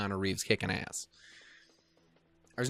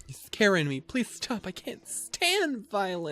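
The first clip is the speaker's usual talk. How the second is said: higher, hammed-up frightened plea; mock scared pleading; 10 semitones higher than usual. Streamer mocking the game thug, hammed-up fake fear. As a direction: pretend to cower, half joking.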